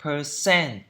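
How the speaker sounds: "percent" with CENT stronger and falling; careful "percent", weight on the last syllable; second-syllable stress. Shown as a demonstration. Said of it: "Trọng âm thứ hai".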